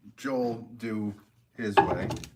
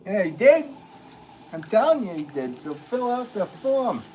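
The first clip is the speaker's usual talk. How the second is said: loud, high New Jersey insistence; Jersey accent; 11 semitones higher, 9 dB louder than usual. Acted. Driver arguing with the claims agent, in a pushy Jersey accent. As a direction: push back hard in a thick Jersey accent, slowing on the last order. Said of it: "I went with the Jersey accent there".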